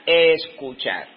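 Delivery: ordinary talk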